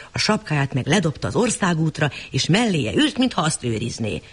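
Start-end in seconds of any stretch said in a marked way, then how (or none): none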